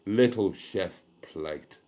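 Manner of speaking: slow, drawn-out Little Chef Plate savoured word by word; with gusto. Shown as a demonstration. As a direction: announce it like a grand title, slowly. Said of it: "but with gusto".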